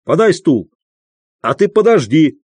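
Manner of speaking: shouted order. A narrator's reading. Postmaster ordering clerk and customer about, shouting. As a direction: order people about briskly. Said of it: "крикнул он бабе".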